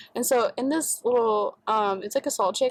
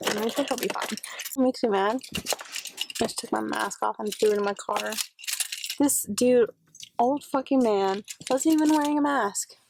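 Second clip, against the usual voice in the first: normal talking voice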